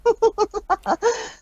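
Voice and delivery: high mocking cackle; villain's laugh. A narrator's reading. Checker mocks Rainbow with a high cackle. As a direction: laugh at her, cruelly amused. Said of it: "Checker gave a laugh".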